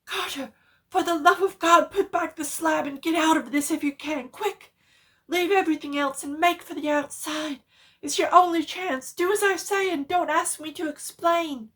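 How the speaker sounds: very high, loud, crying out in terror; urgent pleading scream to flee; desperate shouted warning; wild consternation. A narrator's reading. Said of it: "in a pitch of wilder consternation".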